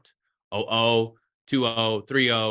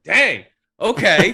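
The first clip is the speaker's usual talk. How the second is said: surprised; 9 semitones higher, 10 dB louder, much slower than usual. Retold or imitated. Impressed by teammates' perfect Spanish.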